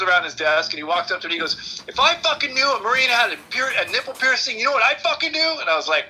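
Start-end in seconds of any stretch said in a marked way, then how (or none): 1.9-5.6 high, heated threat; mock menacing sergeant. First sergeant threatening the interviewer, heated. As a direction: play the hard-ass sergeant menacing.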